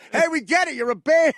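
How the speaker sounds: annoyed comic scolding the band. Retold or imitated. [name] in a robe scolding Pearl Jam at rehearsal, mock irritated.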